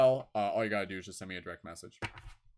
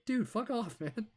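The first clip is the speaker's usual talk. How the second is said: much higher, hammed-up dismissal; mock brush-off; 12 semitones higher, slower than usual. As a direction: shoo the creeper away in jest. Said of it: "You're like".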